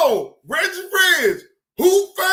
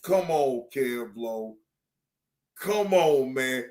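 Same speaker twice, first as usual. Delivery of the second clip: lower, dismissive come on; retold dismissal; 12 semitones lower, 3 dB quieter than usual. Telling Kev Lowe to quit it. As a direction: wave him off low. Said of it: "I say".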